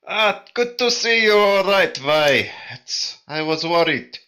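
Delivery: high-pitched, loud acted relief; in-character glad greeting. Player's character greets the embraced friend, high relieved exclamation.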